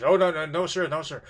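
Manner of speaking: frightened denial. Retold or imitated.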